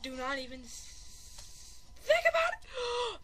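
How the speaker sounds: slow, menacing start to a squeaky finish; deliberate warning. Real, not acted. Repeats warning, slowed down.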